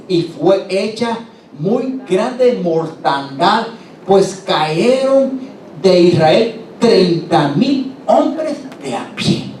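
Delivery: leer el versículo muy despacio y con peso; lectura solemne. Acted. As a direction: cada palabra lenta, como sentencia. Lectura solemne del pasaje bíblico. (lectura bíblica lenta y grave)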